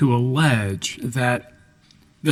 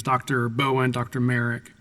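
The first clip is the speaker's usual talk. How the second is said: normal talking voice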